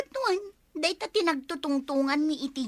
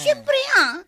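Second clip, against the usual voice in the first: higher, louder boast; proud excited exclaim; excited brag; 12 semitones higher, 7 dB louder than usual. Acted. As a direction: show off the big news. Boasting about party guests.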